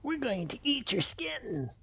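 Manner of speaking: high, strange goblin snarl. Acted. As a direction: menace them like a hungry goblin. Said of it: "My goblin voice".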